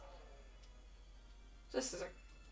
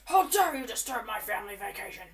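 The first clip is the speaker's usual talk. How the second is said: louder, mock-outraged villain; Bowser voice; 7 dB louder than usual. Gamer imitating Bowser scolding Mario, mock outrage. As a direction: play the indignant king of the Koopas. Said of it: "Bowser talks like this".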